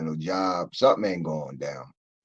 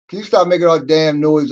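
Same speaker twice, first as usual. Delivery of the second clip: higher, louder complaint up the stairs; annoyed neighbor; 9 semitones higher, 13 dB louder than usual. Retold or imitated. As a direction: yell down the hall at the noisy neighbor. Acted neighbor complaining about noise.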